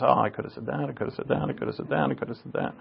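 soft, repetitive replay of inner thoughts; muttered self-reproach. Retold or imitated. Replaying regrets in his head.

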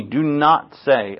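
slow deliberate command. Pretend. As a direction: lay out each word of the command. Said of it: "But the Lord said to me".

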